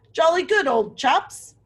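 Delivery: a lightly voiced jolly walrus calling out. A narrator's reading. Teacher reads the walrus welcoming guests, lightly hearty. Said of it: "a walrus called".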